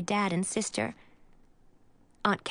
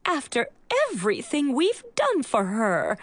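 higher, wounded aunt's voice; a hint of an upset aunt; 6 semitones higher than usual. A narrator's reading. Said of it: "She looked upset".

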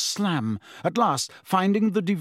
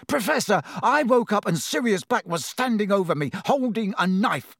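high, agitated account; urgent pleading; 7 semitones higher than usual. A narrator's reading. Ron reporting the intruder to his professor, urgent. Frightened boy pleads to be believed.

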